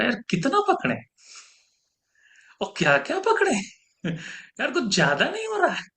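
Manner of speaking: high-pitched, overwhelmed complaint; mock exasperation. Guru voicing a student tired of holding on, mock exasperation. Voices the fed-up student's protest.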